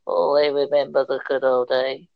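put-on low, nostalgic old-timer voice; skeleton old uncle. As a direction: reminisce as an ancient uncle in the pub. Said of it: "your skeleton uncle in the corner".

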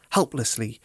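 usual speaking voice

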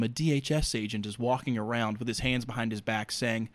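usual speaking voice

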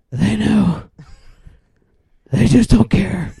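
gravelly growling Batman impression. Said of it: "And Batman's like".

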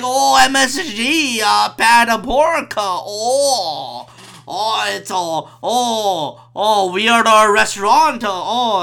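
slow, exaggerated accent with drawn-out moaning ohs; hammed-up mock Chinese accent. Matt mocking a Chinese restaurant, drawn-out mock Chinese accent. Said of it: "He was all like".

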